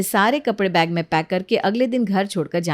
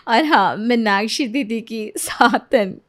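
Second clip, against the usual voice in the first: ordinary delivery, nothing marked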